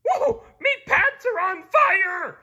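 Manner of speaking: high, loud panicked cartoon scream; full-force high-pitched yell. A narrator's reading. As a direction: shriek it like your pants are burning. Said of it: "screamed Mister Krabs".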